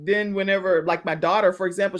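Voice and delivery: ordinary talk, nothing marked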